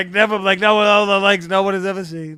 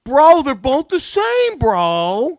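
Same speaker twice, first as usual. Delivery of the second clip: mock bro; 8 semitones higher, 5 dB louder, much slower than usual. Mocking bro talk.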